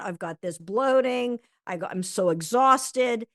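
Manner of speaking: mock-weary friend listing aches and bloating; complaining friend. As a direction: act out an overwhelmed friend unloading. Friend complaining when asked.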